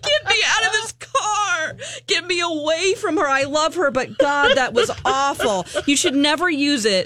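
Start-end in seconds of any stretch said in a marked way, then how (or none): 0.0-5.7 desperate, pleading, half-laughing; mock despair to escape the car; exasperated. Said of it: "I was like".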